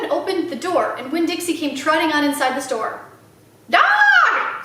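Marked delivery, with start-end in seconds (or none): 3.7-4.6 high, loud squawk; parrot scream. Acted. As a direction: screech the word like a startled parrot. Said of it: "screamed Gertrude".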